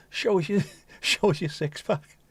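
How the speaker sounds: high, giggly mock flirt; flirty caller, laughing. Caller teasing Jeff on the phone, giggling flirt. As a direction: tease him with a laugh breaking through.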